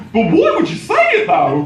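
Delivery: very high, hammed-up Australian accent; bad Australian accent. Barista pressing the Geordie customer, bad Australian accent. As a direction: push the fake Australian right up high. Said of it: "I'm gonna definitely do the accent".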